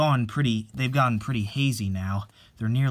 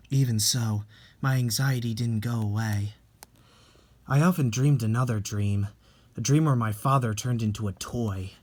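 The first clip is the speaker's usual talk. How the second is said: usual speaking voice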